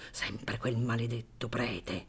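soft, slow inner curse; muttered thought. Acted. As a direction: mutter it under your breath. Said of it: "e pensò".

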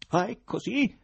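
tono acuto e forte, incredulo; sbalordito. A narrator's reading. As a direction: reagisci incredulo, alzando la voce. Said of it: "proferì Ivan sbalordito". (sbalordito, incredulo)

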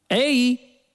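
high-pitched hail; shout. A narrator's reading. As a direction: call out high to raise an answer. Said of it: "gridò Ford".

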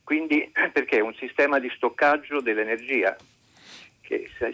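ordinary talk

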